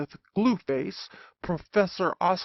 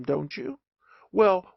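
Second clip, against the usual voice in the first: ordinary talk, nothing marked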